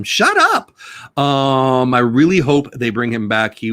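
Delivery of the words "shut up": very high squeaky shut up; mock shut up. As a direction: squeal it at the critics. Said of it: "And I say to them".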